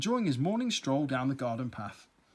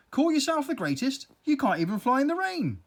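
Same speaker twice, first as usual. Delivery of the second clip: taunting; 10 semitones higher, 4 dB louder than usual. Acted. Earwig taunts the bee.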